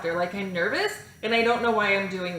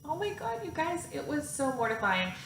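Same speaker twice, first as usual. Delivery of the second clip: laughing; 6 semitones higher, 6 dB quieter than usual. Real, not acted. Laughing at her own story.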